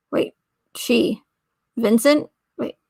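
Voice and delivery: ordinary talk, nothing marked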